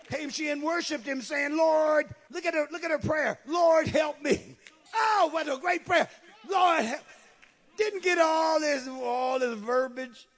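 high, exclaiming scripture reading; exclaiming preaching. Real, not acted. Preacher admiring her short prayer, exclaiming. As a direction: lift the voice and marvel at the prayer.

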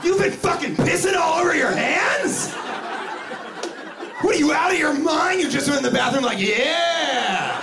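very high-pitched hammed-up shouting; mock outrage. Handshaker accusing greeter about wet hands, shrieked mock outrage. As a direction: rant incredulously, pitch staying way up.

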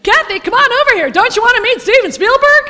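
high, bright call across the room; hammed-up friendly summons; calling her over.

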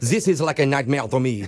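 low, woeful fake French accent; put-on French accent. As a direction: lapse into mock-French lament. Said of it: "in and out of a French accent".

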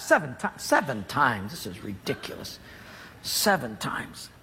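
grumbling. Acted. Naaman grumbling in the river.

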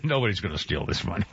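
low, quick mobster voice; tough mobster voice. Retold or imitated. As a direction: reassure her like a wiseguy. Mob guest voiced in story.

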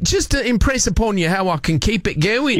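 broad put-on Aussie accent; Australian accent. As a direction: greet them in a hammed-up Australian accent. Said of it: "I'm gonna do the accent".

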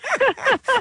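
bright high-pitched giggle. Real, not acted. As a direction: burst into a delighted giggle. Guest laughing at host's praise.

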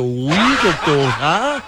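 slow, laughing "Uy, doctora"; flirty laughing. Retold or imitated. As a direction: drawl it, giggling at her. (coqueteo lento entre risas)

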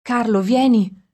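loud, slow call out; broken call. Acted. Exhausted mother calls her husband in, loud and cracking. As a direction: call him in, voice breaking. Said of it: "la voce rotta".

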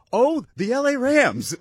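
high, slow, wide-eyed "oh, the Rams"; mock amazed reveal. Host mocking fans' reaction to the trade, drawn-out amazed. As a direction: act out the obvious punchline.

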